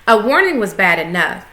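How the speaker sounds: ordinary delivery, nothing marked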